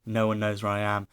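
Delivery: low, hurried panicky self-talk; quietly fretting to oneself; retold freak-out. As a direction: reenact a hushed, fast spiral of dread. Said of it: "freaking out again even more".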